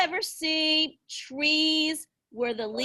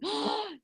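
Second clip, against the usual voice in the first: a high, staged gasp of surprise; surprised gasp; 7 semitones higher, 6 dB quieter than usual. Acted. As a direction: gasp as if the picture amazes you. Teacher reveals a new picture.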